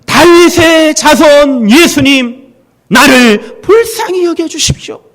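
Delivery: high, slow drawn-out pleading shout; desperate cry. Retold or imitated. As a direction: stretch out the plea as a cry. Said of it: "한 번만 외쳤겠습니까?". (느리고 높게 길게 외치는 애원)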